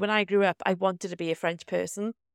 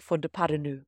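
soft mock French Fond de paranu; Fond de paranu in a quiet put-on French accent; a hint of French accent; 4 semitones lower, 5 dB quieter than usual. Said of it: "said in a French accent".

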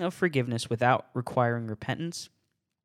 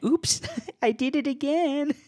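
high mock-cute voice; mocking sing-song. Mocking a careless believer in a high cutesy voice. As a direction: ham up a careless sinner. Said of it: "I'll say it again".

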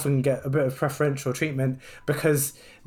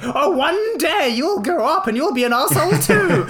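much higher, louder mock-sweet voice; mocking nurse's pep talk; 12 semitones higher, 5 dB louder than usual. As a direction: parody her patronising advice.

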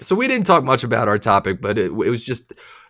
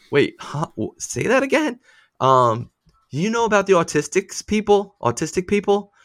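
confused surprise; 9 semitones higher than usual. Retold or imitated. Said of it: "I went".

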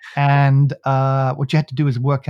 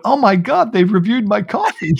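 high, thrilled gush of a listener; mock-excited American reviewer, pitched up; 8 semitones higher, 5 dB louder than usual. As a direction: play the delighted fan who spots his coffee. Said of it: "listened to our podcast and went".

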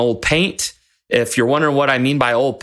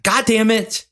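higher-pitched exasperated curse; frustrated coach; 8 semitones higher than usual. Retold or imitated. Coach fed up with bucked-off rider, mildly exasperated. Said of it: "he was getting frustrated".

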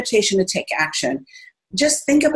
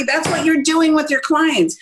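normal talking voice

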